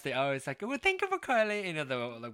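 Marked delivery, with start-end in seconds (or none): 0.6-1.7 high-pitched Indian woman on customer service; put-on Indian accent, much higher. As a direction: imitate an Indian phone agent, voice pitched up. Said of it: "so that's my Indian accent".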